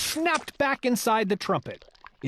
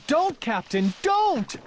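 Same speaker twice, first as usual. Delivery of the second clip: rising to a much higher cry; desperate plea; 6 semitones higher, slower than usual. Acted. Bartholomew tries to stop the captain, rising higher desperate cry. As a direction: stop him in time.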